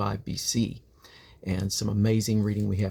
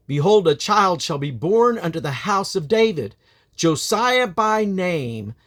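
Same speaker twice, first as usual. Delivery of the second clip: raised, louder proclamation; prophetic cry; 7 semitones higher, 5 dB louder than usual. Acted. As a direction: cry out the prophecy against the altar. Prophet cries against the altar.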